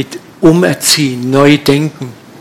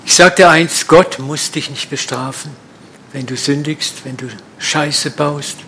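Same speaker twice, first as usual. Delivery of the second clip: ordinary delivery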